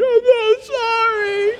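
put-on high, slow, wailing child apology; crying child. Sobbing child apologizing to daddy, high wailing voice. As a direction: wail it like a sobbing little kid. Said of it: "already crying".

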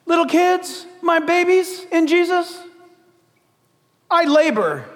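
put-on a father soothing his little kids; tender paraphrase. Shown as a demonstration. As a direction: speak to them like little babies. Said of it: "Listen, this is the great theologian Paul".

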